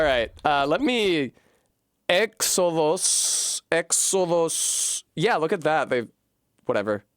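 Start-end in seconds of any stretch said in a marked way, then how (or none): 2.1-5.0 Exodus read slowly and higher, as if from Greek letters; sounding it out. Real, not acted. Streamer reading a Greek menu word, slow and high. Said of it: "reading the Greek word aloud".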